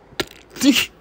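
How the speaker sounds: sudden loud high giggle; burst of giggles. Real, not acted. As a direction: break into helpless giggles. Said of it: "so I could get the giggles out".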